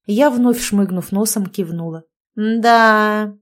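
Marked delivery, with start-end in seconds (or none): 2.4-3.4 loud, long-held word; drawn out. A narrator's reading. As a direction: stretch it out meaningfully. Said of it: "протянула Марья". (протяжно)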